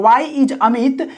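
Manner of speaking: very slow, word by word; dictating slowly to the class; slow dictation. Shown as a demonstration. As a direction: spell it out slowly for students. Dictating the English translation.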